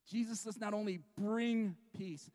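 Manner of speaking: ordinary delivery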